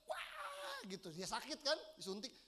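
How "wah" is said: high piercing mock baby cry, very high; imitated baby wail. Preacher imitating his crying baby's high wail. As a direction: imitate the baby's scream at the injection. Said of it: "Dia teriak".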